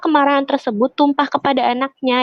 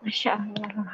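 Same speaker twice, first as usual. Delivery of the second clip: a soft, breathless whisper of awe; hushed whisper; 3 semitones lower, 13 dB quieter than usual. Real, not acted. Host moved by the doctor's answer, whispering in awe.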